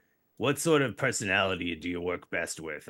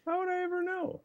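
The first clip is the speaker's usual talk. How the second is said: mock high voice; 7 dB quieter than usual. GM dodging question, mock voice.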